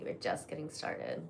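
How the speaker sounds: soft teasing whisper. Shown as a demonstration. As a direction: whisper it close, playful. Repeats line, second whispered.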